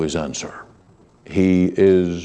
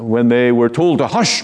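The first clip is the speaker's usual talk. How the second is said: ordinary delivery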